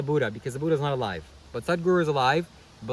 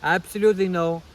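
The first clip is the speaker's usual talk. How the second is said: louder, higher imitation of an Indian guru accent; Sadhguru accent; 5 semitones higher, 5 dB louder than usual. Vlogger resuming the guru's answer on the slap, louder Sadhguru accent. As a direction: declare it firmly in a mimicked Indian guru accent. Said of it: "I can't do a good Sadhguru accent".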